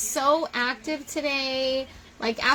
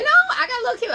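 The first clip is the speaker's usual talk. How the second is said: playful; 12 semitones higher, 7 dB louder, much faster than usual. Real, not acted. Bragging about being cute.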